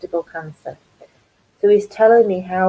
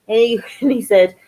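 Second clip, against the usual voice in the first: ordinary delivery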